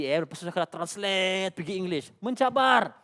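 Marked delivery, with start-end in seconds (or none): none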